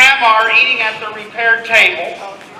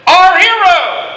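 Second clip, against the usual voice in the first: cheering; 7 semitones higher, slower than usual. Acted. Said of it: "Everybody says".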